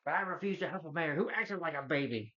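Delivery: sneering movie-villain line, lightly played; a hint of a scornful cartoon villain; Penguin quote. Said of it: "Just quoting Penguin from".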